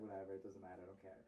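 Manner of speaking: low, soft brush-off; retold annoyed dismissal. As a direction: imitate a sulky shrug-off, voice dropping. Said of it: "I was pissed".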